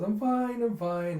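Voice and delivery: high, loud, then easing down; breezy insistence. Acted. Princess prancing off after stumble, cheerful insistence. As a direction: brush off the worry brightly. Brushing off near fall.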